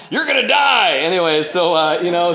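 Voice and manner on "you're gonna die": high, raised-voice doom cheer; mock cheerleading. Imitating his sponsor as cheerleader, mock dire warning. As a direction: cheer them on to their doom.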